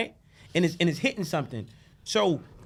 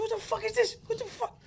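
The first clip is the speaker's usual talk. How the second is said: far higher, stunned, fading softer; retold shock, much higher; shocked disbelief; 8 dB quieter than usual. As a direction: gasp it out as the tears hit. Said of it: "I said".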